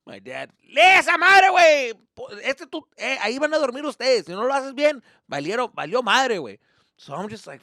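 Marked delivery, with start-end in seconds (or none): 0.8-6.6 loud high father; dad yelling. Retold or imitated. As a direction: scold him about the tent.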